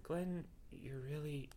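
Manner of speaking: soft, slow, halting confession; struggling sincerity. A narrator's reading. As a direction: say it haltingly, as if difficult. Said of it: "like she was saying something difficult".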